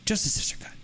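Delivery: whispered, as if revering it; soft, voiceless echo of the phrase; hushed whisper. Real, not acted. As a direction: repeat it under your breath in a whisper. Reverent hushed repeat of catchphrase.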